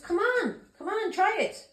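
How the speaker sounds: high, goading come on try it; a mock dare thrown at the sisters; taunting dare. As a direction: egg them on to try.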